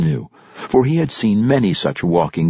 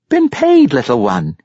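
plain reading voice

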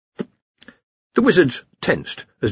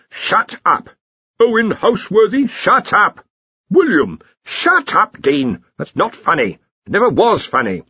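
high, raised-voice bickering; irritated quarrel; 10 semitones higher, 4 dB louder than usual. A narrator's reading. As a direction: snap back at a teasing colleague. Acted quarrel over mocking names.

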